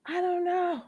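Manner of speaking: high, quiet mock-evasive dodge; a light, high brush-off; imitating Krista. Retelling Krista dodging the question, high and evasive. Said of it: "So she was like".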